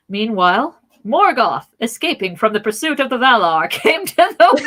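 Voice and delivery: booming forties newsreel narrator; old newsreel. Acted. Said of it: "That was the most Hanna-Barbera-esque narration".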